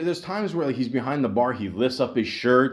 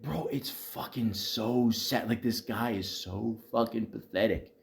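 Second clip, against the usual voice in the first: hushed disdain; 3 semitones lower, 8 dB quieter than usual. Real, not acted. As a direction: drop the volume and drag out the words. Dwelling on coworker's patheticness.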